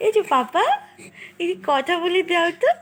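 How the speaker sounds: high, loud call to papa. Real, not acted. Mother calls to baby's papa.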